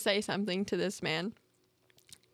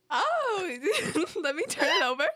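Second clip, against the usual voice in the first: laughing through words; 7 semitones higher, 3 dB louder than usual. Retold or imitated. Her embarrassed reply retold.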